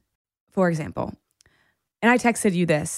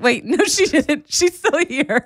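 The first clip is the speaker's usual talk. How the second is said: laughing realization; 8 semitones higher, 3 dB louder, slower than usual. Retold or imitated. Retelling her own surprised realization.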